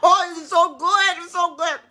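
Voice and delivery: high giggle, then slow laughing words; delighted laughter. Real, not acted. Cook laughing with delight over her food, laughing. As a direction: laugh through how good it tastes.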